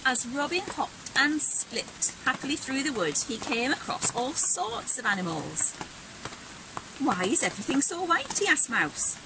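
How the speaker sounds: put-on bright, animated read-aloud for kids; lively storybook reading. A narrator's reading. Teacher reading Robin's winter story aloud, animated and bright. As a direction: act the story out with energy.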